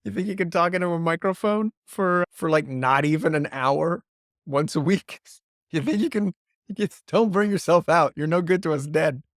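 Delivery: mild mock scolding of a slacker podcaster; mock skeptical listener. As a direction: tease like a doubtful listener with a real job. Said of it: "Someone who has a real job is listening to this and is like".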